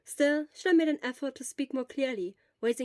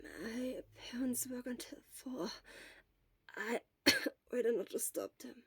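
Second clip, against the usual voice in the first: weak whisper; 9 dB quieter than usual. Acted. Sick boy whispers to the nurse about his parents. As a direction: barely voice it, coughing midway.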